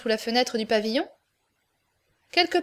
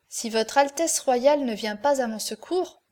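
usual speaking voice

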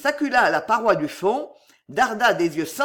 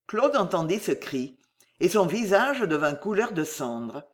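ordinary delivery, nothing marked

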